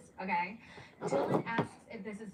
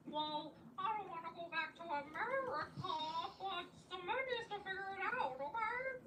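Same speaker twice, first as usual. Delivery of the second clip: higher, whiny voice that cracks toward crying; mock teary girlfriend pleading; Jenny near tears; 8 semitones higher than usual. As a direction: play her on the edge of tears.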